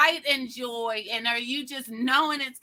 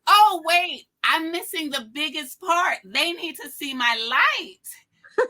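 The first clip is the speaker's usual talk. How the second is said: high, loud, eureka burst; a hammed-up lightbulb moment, slowing on the last words; excited realization; 7 semitones higher, 9 dB louder than usual. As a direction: shout out the big idea you just had. Acting out her own sudden idea.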